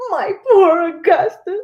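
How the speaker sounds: anguished mother wailing her son's name; wailing cry. A narrator's reading. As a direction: cry out for her lost son. Mother fears son turned to fudge.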